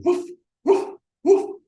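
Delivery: high yappy woof woof imitation; dog barking. Dog in the car barking at the dog food poster, yappy barks. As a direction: bark like a small dog at the poster.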